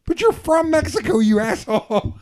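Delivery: high, exasperated mock outburst; exasperated protest. Arguing back at young friend, high exasperated protest. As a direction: throw up your hands in disbelief. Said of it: "I was like".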